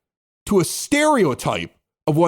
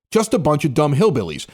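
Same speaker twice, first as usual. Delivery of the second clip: ordinary talk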